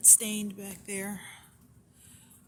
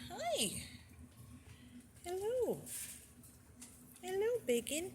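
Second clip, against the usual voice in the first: much higher sing-song pet greeting; 12 semitones higher, 7 dB quieter than usual. Real, not acted. To Bacon the rabbit, high sing-song greeting. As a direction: greet the bunny in a tiny high voice.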